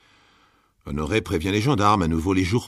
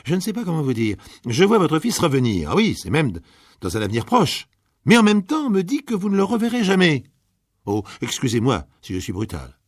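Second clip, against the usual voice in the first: higher and louder, building to a near cry; excited fortune teller; 9 semitones higher, 7 dB louder than usual. Acted. Fortune teller telling Gabriel her son returns, excited. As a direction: let the vision rise in pitch.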